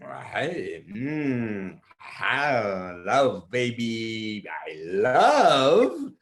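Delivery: low, slow growly bear; hungry bear voice. Acted. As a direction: rumble like a greedy bear. Bear's voice.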